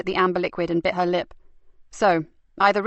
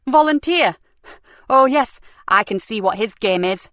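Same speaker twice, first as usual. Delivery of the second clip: loud, higher scoff at the word; mocking outrage, pitched up; scornful disbelief; 3 semitones higher, 5 dB louder than usual. Nikki scoffing to her super about the volunteer, loud and scornful.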